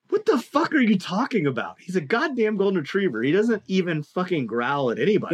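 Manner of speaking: retold outburst, pitched high at first; mock-incredulous comeback, leaning on the swearing; indignant retort. Dog owner defending his retriever to an older lady, indignant.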